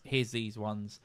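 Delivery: normal talking voice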